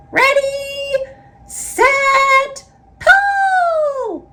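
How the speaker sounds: very high shouted bat call. A narrator's reading. Little bat calls the count to the others, high shout. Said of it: "called the bat".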